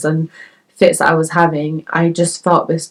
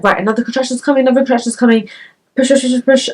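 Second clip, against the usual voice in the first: fast, higher urgent count; urging push; 6 semitones higher, faster than usual. Retold or imitated. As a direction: rush them into the push. Midwives urging again.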